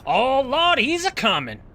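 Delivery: loud, high mock panic; hammed-up alarm. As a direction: holler a playful warning way up high. Mock alarm as worm arrives.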